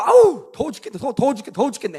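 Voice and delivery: mock exasperated outburst, loud at first then easing; hot-tempered griping. Churchgoer griping about heat on leaving church, mock exasperation. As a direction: burst out complaining in imitation. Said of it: "가자마자 막 열받습니다".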